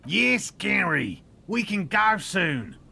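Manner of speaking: slow, loud, high holler; calling back. Acted. Answering Gary about going back, shouted slowly. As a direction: stretch each word out as you shout it. Calls back to waiting companion.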